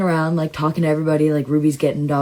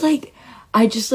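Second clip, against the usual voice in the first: usual speaking voice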